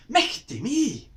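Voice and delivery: loud, high startled cry; a big Scots yelp of fright; exclaiming Michty me. Shown as a demonstration. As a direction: shout it like a spider just jumped out. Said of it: "something you might shout if you saw a spider".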